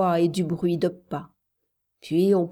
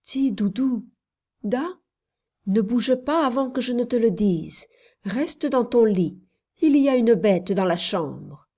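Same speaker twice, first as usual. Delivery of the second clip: ordinary delivery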